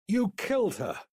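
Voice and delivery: high, loud accusing shout. A narrator's reading. Boy shouting at his friend he blames. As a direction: hurl the accusation at him. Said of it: "Nathan shouted".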